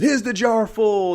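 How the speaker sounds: high, loud, drawn-out challenge; slow, rousing shouted question; emphatic professor. Retold or imitated. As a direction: boom it out to rouse a classroom. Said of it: "he slams it down and goes".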